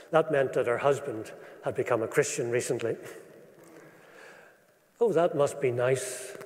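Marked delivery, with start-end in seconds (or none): none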